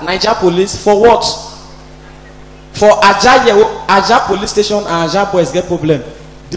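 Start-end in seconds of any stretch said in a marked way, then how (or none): none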